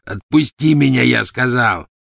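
angry demand. Acted. Werewolf demands release angrily.

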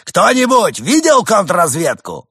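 high, loud outraged rant; ranting demagogue. Acted. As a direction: whip up the crowd.

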